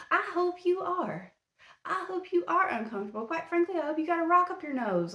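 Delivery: high, mocking jab at the accused; sarcastic taunt. Real, not acted. Host taunting the accused father Chad, high and mocking. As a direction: sneer the wish at him sweetly.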